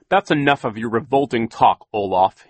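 loud, stern reprimand. Acted. Poe silences Olaf's revolting talk, stern. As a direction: cut the villain off firmly and loudly. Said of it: "he said sternly".

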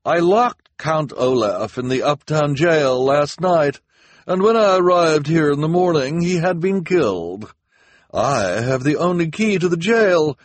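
high, loud pompous announcement; officer's grand public address. A narrator's reading. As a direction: proclaim it to the whole crowd. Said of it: "she said grandly".

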